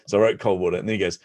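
ordinary delivery

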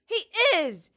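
high, very loud contradiction; indignant girlish outburst; insistent. A narrator's reading. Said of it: "Sansa insisted".

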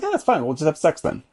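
mock breezy woman. Co-host voicing the film's woman, breezy and high-pitched. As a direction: toss it off lightly in a high girly voice.

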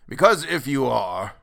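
put-on querulous old man. A narrator's reading. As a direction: grumble in an ancient voice. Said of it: "went on the old man querulously".

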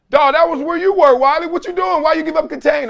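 high, exasperated what-are-you-doing; incredulous teammates. Retold or imitated. Players asking what he was doing, high and exasperated. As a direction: teammates yelling in disbelief at his blown contain. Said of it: "all of us probably like".

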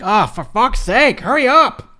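loud, rising exasperated groan; exasperated impatience. Retold or imitated. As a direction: groan it out loudly, urging them to hurry. Acts out impatience with long match.

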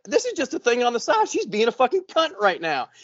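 mock whiny old guy; mock old guy complaining. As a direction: act out the jealous old man. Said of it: "He's like".

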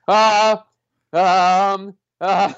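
loud, high, drawn-out "ah... um... uh"; hammed-up helpless stalling noises; imitated dumbstruck noises. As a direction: act out a brain stalled in panic. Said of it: "I literally made these noises".

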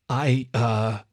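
hesitant little stutter; falter, searching for the words. A narrator's reading. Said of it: "the fox stuttered for a moment".

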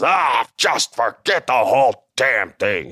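slow, disgusted dismissal; fed-up Vince. Retold or imitated. Boss quitting the promo class, slow and fed up. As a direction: give up on the whole room in disgust. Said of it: "And Vince goes".

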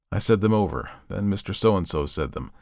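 ordinary delivery

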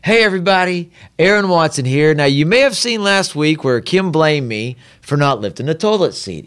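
high, upbeat vlog-style intro; video host greeting. Retold or imitated. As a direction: imitate addressing your followers on camera. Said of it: "I made a video and I was like".

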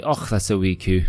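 demonstrating a Scottish "wee coo"; mock Scottish accent. Shown as a demonstration. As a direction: put on Scots with a throaty "och". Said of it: "I taught Logan how to say".

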